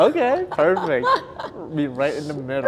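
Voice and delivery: giddy laughing approval; high laughing. Real, not acted. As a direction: squeal it through laughter. Both laughing together.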